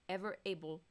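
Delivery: ordinary talk, nothing marked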